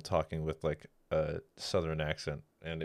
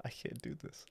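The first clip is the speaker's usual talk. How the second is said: whispered; 8 semitones higher, 17 dB quieter than usual. Real, not acted. Breaks down laughing, whispered.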